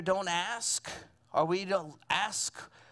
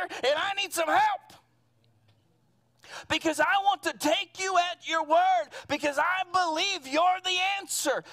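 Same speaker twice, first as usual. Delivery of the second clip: higher, louder and pleading; preaching prayer; 6 semitones higher, 3 dB louder than usual. Acted. Preacher crying out to God in prayer, pleading and raised. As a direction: lift it up to God with passion.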